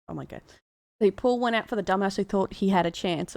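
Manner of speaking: usual speaking voice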